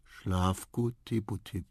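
drowsy goodnight, ending very drawn out; sleepy murmur. A narrator's reading. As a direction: murmur it while falling asleep. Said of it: "schloss die Augen und murmelte". (schläfrig murmelnd einschlafen)

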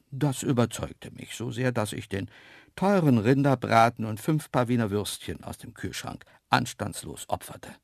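ordinary delivery

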